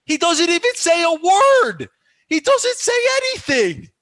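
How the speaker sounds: full high-pitched yell of protest; outraged rant. Real, not acted. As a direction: yell at the referee in real indignation. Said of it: "Sorry, my little rant".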